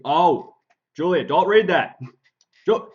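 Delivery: loud urgent call across the room; shouting. Real, not acted. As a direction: yell her name to stop her. Calling out to stop Julia.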